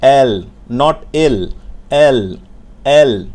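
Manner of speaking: এল said smoothly, the l glided. Shown as a demonstration. Teacher modelling a smooth এল for the class. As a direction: roll এল out gently and smoothly. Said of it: "একটু মসৃণ করে বলতে হবে".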